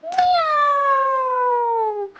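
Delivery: long, high, gliding meow; cat meow. Acted. Leading children to join in as cats, long meow. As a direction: stretch out a high mew. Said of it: "meow like a cat with me".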